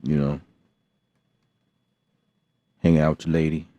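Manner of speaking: ordinary talk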